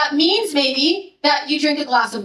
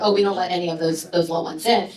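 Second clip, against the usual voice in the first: deadpan quote; 6 semitones lower, 3 dB quieter, faster than usual. Retold or imitated. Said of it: "that A U C leader said what he did".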